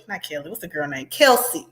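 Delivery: ordinary delivery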